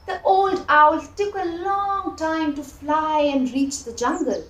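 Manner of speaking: plain reading voice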